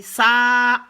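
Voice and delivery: "ça" with a long, loud aa; drawn-out vowel. Shown as a demonstration. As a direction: stretch the a of "ça". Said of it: "si on veut tirer".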